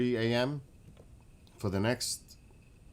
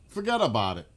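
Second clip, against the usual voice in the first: put-on New York accent; 6 dB louder than usual. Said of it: "New York accent".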